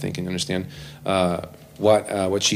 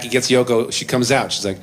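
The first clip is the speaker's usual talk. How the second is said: ordinary talk, nothing marked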